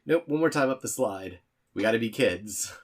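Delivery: high, loud, playful protest; eager insisting. Acted.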